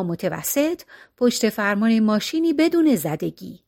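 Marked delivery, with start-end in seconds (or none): none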